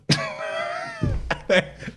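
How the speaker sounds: very high, airy burst of laughter. Real, not acted. Paying off the card with cash to the host, a very high laugh.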